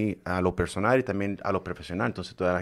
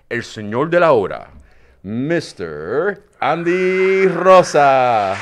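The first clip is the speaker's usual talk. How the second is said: slow, loud, drawn-out ring announcer call; big stage introduction, stretched and loud; announcer intro; 4 semitones higher, 9 dB louder, much slower than usual. Acted. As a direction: boom out the guest's name like a boxing emcee. Host announcing guest like emcee.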